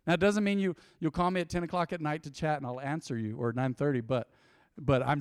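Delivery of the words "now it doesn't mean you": ordinary talk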